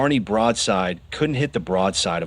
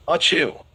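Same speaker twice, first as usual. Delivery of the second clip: sharp, sudden sneeze; 6 semitones higher than usual. A narrator's reading. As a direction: burst out with a sneeze. Beef sneezes.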